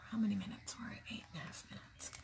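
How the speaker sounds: quick hushed whisper; asking someone off camera about the time in a whisper; whispered aside. Real, not acted. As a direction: check the time under your breath.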